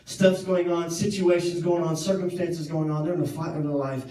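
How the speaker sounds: steady, earnest preaching; sermon point. Acted. Preacher applying the story to the congregation, earnest. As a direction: drive the point home to the congregation.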